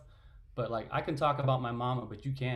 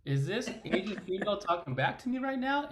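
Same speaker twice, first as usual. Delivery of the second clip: incredulous, higher-pitched imitation; mock disbelief; 7 semitones higher than usual. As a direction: act out someone taken aback at being answered back. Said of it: "It's like".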